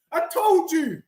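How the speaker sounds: usual speaking voice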